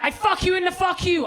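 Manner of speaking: high-pitched mock rage; mock furious. Catfished man cursing his troll, high mock fury. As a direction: rant it high, in comic fury. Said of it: "a furious message".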